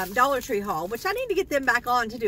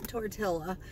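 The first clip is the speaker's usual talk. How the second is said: lightly voiced Napoleon Dynamite, softer and lower, tortilla; a hint of Napoleon Dynamite, softer, saying "Tortilla."; Napoleon Dynamite impression; 4 semitones lower, 8 dB quieter than usual. Doing Napoleon Dynamite for her haul viewers, softly mumbled. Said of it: "I'm gonna say it like Napoleon Dynamite".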